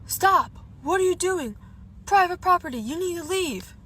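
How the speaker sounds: high, loud mock authority yell; retold yell. Stranger yelling at trespassers at the quarry, retold yell. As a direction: imitate someone ordering trespassers out. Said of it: "this deep voice yell".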